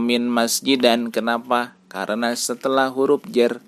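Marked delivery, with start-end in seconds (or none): 0.0-1.1 "min masjidan" closing on -an; wrong -an ending. Shown as a demonstration. Teacher showing the misreading, "min masjidan" ending in -an. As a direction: end "min masjidan" with a and n instead of -in. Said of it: "Tidak dibaca".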